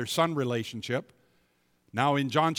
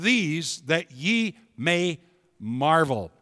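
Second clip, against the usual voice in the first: loud, slow, raised scripture reading; full-force preaching; 4 semitones higher, 5 dB louder than usual. Acted. Preacher reading Jesus' words to the congregation, loud and slow. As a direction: thunder the verse out slowly.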